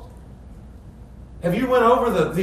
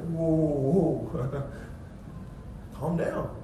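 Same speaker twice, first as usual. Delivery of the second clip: lower, soft, slow calming; calming Jesus; 5 semitones lower, 10 dB quieter, much slower than usual. Retold or imitated. Jesus calming the overeager scribe, soft and slow. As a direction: ease him down gently.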